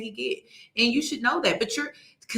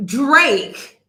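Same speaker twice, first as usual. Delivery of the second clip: loud incredulity; 2 semitones higher, 7 dB louder than usual. Real, not acted. Incredulous repeating, escalating.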